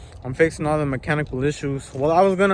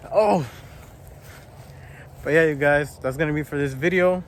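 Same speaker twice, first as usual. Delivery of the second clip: ordinary delivery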